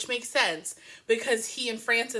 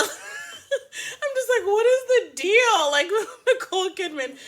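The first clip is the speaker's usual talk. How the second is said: high, drawn-out, laughing incredulity; cracking up; 11 semitones higher, 6 dB louder than usual. Real, not acted. Host baffled by the actress's antics, cracking up. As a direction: squeal it slowly through real laughter.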